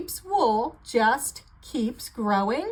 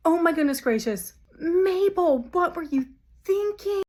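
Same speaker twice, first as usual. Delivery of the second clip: fast, higher fretful scolding; alarmed scolding; 6 semitones higher, faster than usual. Acted. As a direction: fuss over a reckless friend. Sheep scolds Mabel in surprise.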